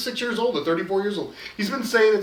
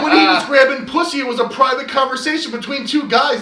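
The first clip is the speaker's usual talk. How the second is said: louder and higher, laughing as he argues; mock-heated rant with laughter breaking in; heated laughing retort; 5 semitones higher, 5 dB louder than usual. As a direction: hammer the point through a laugh. Retelling his heated comeback.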